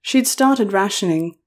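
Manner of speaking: ordinary delivery, nothing marked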